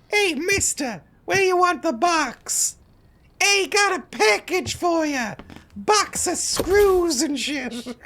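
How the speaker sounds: very high, cartoonish courier; delivery guy voice. Courier calling a mister about a package in a high cartoon voice.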